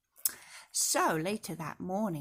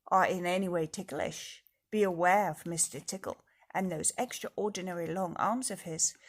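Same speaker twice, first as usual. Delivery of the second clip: usual speaking voice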